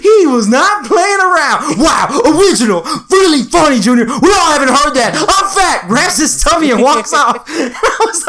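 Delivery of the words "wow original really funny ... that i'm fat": sarcastic outburst. Retold or imitated. Danny snapping at his brothers after a fat joke, sarcastic outburst. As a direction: snap back at the teasing, hurt.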